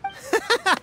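a high, jittery laugh to smooth things over; nervous laugh. Acted. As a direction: laugh it off, scared underneath. Nervous laugh before guards.

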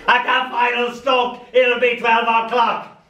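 lightly Dalek-flavoured poem reading. Acted. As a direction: read it half in a Dalek drone.

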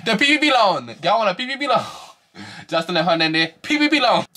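high, loud laughing repeat; excited laughing. Real, not acted. Joking about Jocelyn's loan, high and laughing. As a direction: laugh it out while mocking the loan.

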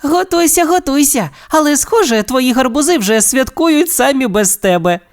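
very high, playful character voice; teasing Alex. A narrator's reading. Alex mocking Steve's festive preparations, teasing. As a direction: needle him playfully, high-pitched.

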